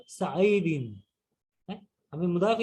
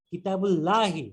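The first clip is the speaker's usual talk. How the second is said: ordinary delivery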